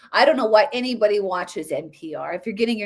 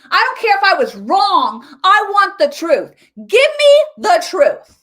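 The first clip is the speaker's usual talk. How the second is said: louder, higher demands for the truth; loud rant; 7 semitones higher, 8 dB louder than usual. Real, not acted. Commentator loudly demands the truth from the audience, shouting. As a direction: shout it out, fed up.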